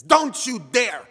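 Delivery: a grandmother yelling at the grandson trying to flee the room; furious shout. Retold or imitated. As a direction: order him back into the room at full volume. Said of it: "looks at me and says".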